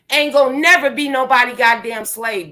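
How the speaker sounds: usual speaking voice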